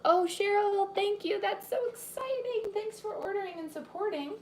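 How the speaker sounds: high, bright squeal of thanks; excited thanks. Real, not acted. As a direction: gush with delight at the order. Thanking viewer for ordering book.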